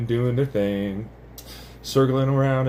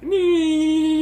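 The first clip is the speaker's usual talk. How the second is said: very high thin nee; a tiny piercing tone; high squeal; 3 dB louder than usual. Retold or imitated. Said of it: "it's like".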